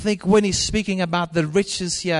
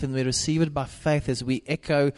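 normal talking voice

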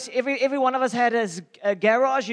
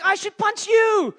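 much higher, louder shout; angry shout; 11 semitones higher, 4 dB louder than usual. Retold or imitated. Retelling shouting at the big neighbour, much higher and louder. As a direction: threaten him back in a yell. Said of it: "And I'm like".

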